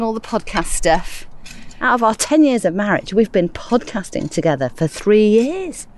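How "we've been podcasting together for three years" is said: usual speaking voice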